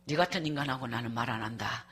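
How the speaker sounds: low, quiet dismissal; scornful muttered retort. Retold or imitated. Wife inwardly rejecting husband's apology, quietly scornful. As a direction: drop low and shut them out.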